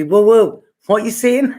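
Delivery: mock the friend who can't follow the accent; laughing imitation of a puzzled Londoner; baffled flatmate. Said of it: "he said to me".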